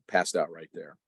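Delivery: ordinary delivery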